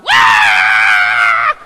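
loud low wail of a class in panic; loud group wail; kids' panicked wail. Retold or imitated. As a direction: wail like frightened kids. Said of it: "twenty-four kids all going".

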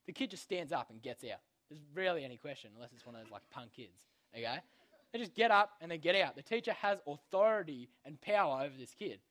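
ordinary talk

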